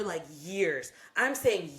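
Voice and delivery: ordinary delivery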